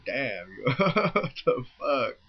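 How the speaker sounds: slow, drawn-out words broken by laughter; laughing disbelief. Retold or imitated. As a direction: retell cracking up in disbelief. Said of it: "I'm laughing".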